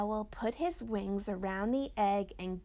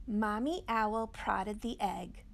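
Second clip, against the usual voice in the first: ordinary delivery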